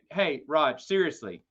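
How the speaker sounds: ordinary talk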